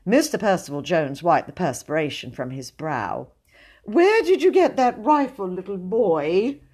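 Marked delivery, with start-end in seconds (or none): 3.8-6.6 high, strained jollity; falsely light question, drawn out at the end; forced playfulness. A narrator's reading. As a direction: try to sound playful while scared. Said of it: "a voice he strove to make playful".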